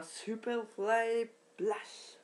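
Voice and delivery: slow, stretched-out move name; hyped wrestling-move announcement; showy announcer call. Pretend. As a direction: draw out each word like a ring announcer.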